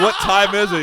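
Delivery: loud, high laughing yell; mock shout. Woken friends shouting in shock, laughing. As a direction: blurt it out loud in disbelief. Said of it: "We go".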